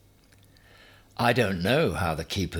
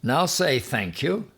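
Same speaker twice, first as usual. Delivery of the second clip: raised, firm voice telling porcupine to say thanks; Ham's command; 8 semitones higher, 5 dB louder than usual. A narrator's reading. Ham commands the porcupine: raised firm voice. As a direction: order him, loud and high.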